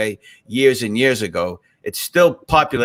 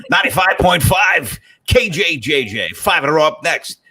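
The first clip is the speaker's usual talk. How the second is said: higher, hammed-up station ident; radio DJ; 3 semitones higher than usual. Host clowning as a station DJ, punchy radio voice. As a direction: punch out the call letters like a mock DJ. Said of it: "like more like a radio voice".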